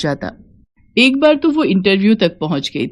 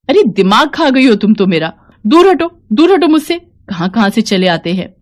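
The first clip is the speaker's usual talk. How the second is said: scolding officer; 2 semitones higher, 3 dB louder than usual. Acted. Officer scolding a pleading woman at her desk, loud and arrogant. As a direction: shoo the woman away with contempt. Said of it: "वो किसी औरत को डांट रही है".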